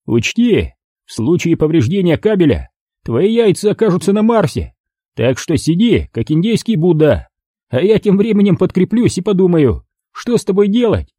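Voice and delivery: put-on high, sneering young thug voice; mocking thug. Thug sneers at the beaten narrator in a high mocking voice. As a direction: jeer down at the old man on the ground.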